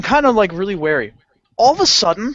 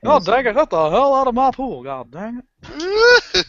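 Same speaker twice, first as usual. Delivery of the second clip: high, drawn-out country grumble; hammed-up cranky homeowner; 8 semitones higher, slower than usual. Owner shooing the kid out of his pool, mock country grumble.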